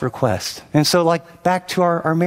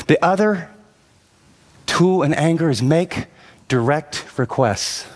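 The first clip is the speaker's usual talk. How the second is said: normal talking voice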